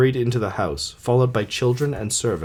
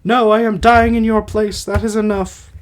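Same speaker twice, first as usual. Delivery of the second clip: high, louder put-on wife's voice; Alcestis voice; 11 semitones higher, 4 dB louder than usual. Dying wife refusing her husband's plea, high put-on voice. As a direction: refuse him in a high theatrical voice. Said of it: "I'll try to do voices".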